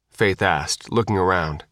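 usual speaking voice

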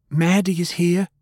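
high, urgent shout; Faye shouting. A narrator's reading. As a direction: call out the alarm up high. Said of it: "Faye shouted".

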